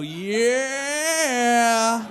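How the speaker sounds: loud high whoop of yeah; mock triumphant yeah. Cheering at the boss over a bomb threat, loud high whoop. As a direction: cheer it like a big win. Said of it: "I go".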